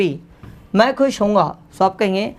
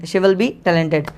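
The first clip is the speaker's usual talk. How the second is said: slow dictation; 2 semitones lower, much slower than usual. Shown as a demonstration. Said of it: "so आप कहेंगे".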